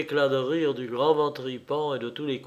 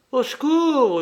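high, loud howl; howling for help; 11 semitones higher, 7 dB louder than usual. A narrator's reading. Prince yelling for help as the horse attacks, howl. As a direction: howl for rescue at a high pitch. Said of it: "hurlait Horribilis".

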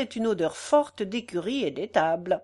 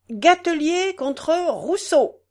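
loud, higher, slow call of the case; clerk calling out; 7 semitones higher, 6 dB louder, slower than usual. A narrator's reading. Clerk calling out the case without moving, loud. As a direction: call the case out across the room. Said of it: "cria-t-il".

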